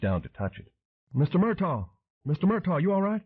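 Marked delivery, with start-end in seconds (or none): 1.1-1.9 urgent call. A narrator's reading. Calling to fallen man. 2.2-3.2 urgent call, pitched high. A narrator's reading. Dan calling to the fallen man: worried, high call.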